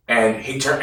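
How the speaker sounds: ordinary delivery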